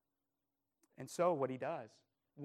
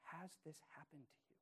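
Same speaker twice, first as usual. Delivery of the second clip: soft, searching question, much softer; hushed question; 18 dB quieter, faster than usual. Real, not acted. Pastor asks the congregation personally, softly. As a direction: ask it gently, almost to yourself. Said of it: "I need to ask this question".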